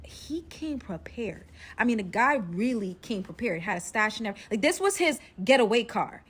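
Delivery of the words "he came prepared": slow, whispered disbelief; stunned whisper. Retold or imitated. Captive marvelling at captor's spare gas, slow whisper. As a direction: whisper it to yourself, stretched out.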